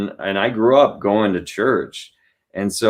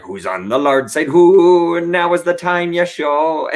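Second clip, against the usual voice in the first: put-on slow, loud pirate accent singing; 8 semitones higher, 4 dB louder than usual. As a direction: belt the hymn like a pirate. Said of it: "with a pirate is like".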